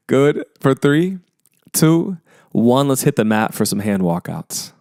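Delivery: normal talking voice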